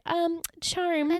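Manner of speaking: high, prissy put-on charmed. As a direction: play it prim and unimpressed.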